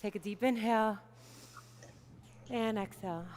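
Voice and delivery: low, soft, with long pauses; calm centering. Real, not acted. Teacher guides kids to breathe and center, calm. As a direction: settle them down softly, voice dropped.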